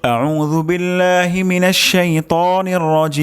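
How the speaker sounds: slow, high, melodic tilawat; Quran recitation. Shown as a demonstration. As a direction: recite it slowly in chanted tajweed. (ধীর সুরেলা তিলাওয়াত)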